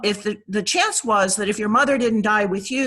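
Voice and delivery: ordinary delivery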